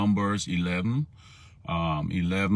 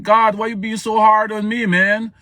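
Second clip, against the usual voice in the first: high, loud exasperated prophet; Moses complaining; 8 dB louder than usual. Acted. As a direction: vent at God like an overwhelmed leader. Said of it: "Moses like".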